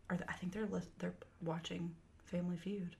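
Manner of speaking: hushed whisper so family won't hear. Real, not acted. Host noticing family might overhear, whispering. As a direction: whisper it, they might be listening.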